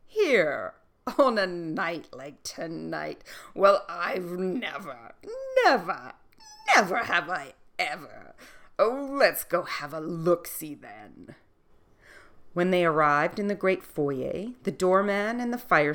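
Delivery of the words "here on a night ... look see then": a hint of a jolly old king; slow, amused king's voice; roaring tyrant king. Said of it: "The king roared with laughter".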